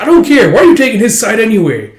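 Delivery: very high, loud, quick snapping; angry outburst. Retold or imitated. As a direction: blow up at her. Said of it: "It's like".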